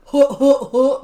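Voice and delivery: loud, repeated oafish "huh"; mock dumb-role grunts. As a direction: punch out goofy grunts, mocking the role. Mimics a dumb comedy role.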